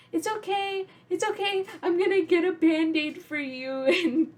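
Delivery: high, mock-soothing big sister voice; calm him down sweetly while faking it; imitating a child reassuring her crying brother. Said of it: "And I'm just like".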